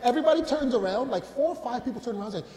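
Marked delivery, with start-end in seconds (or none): none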